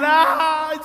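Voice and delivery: loud, high mock wail; imitated crying. Upset woman crying at airline staff, wailing. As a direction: bawl out loud, acting it. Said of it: "And she's crying".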